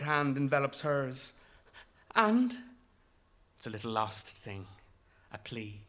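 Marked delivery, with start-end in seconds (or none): none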